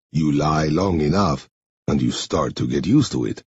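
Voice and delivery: low, measured man's voice; low-pitched, deliberate character voice; Henri character voice. Acted. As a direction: play Henri low and subdued, slightly foreign.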